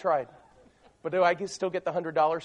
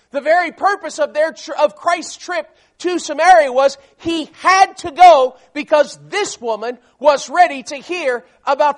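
loud, raised preaching voice; forceful preaching; 6 semitones higher, 11 dB louder than usual. Real, not acted. Preacher explains the servant word to congregation, forceful preaching. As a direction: drive each phrase home to the congregation.

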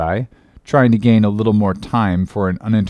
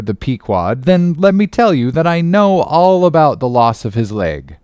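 raised, irritated retort; annoyed; 5 semitones higher, 5 dB louder than usual. A narrator's reading. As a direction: push back with rising impatience. Ishmael annoyed.

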